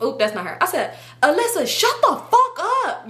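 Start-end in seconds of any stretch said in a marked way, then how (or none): 1.2-3.1 high, exasperated mock yell; re-enacted yelling. Speaker silencing her pleading friend, acted yell. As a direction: yell it out, pitched way up. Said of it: "Y'all, I started yelling".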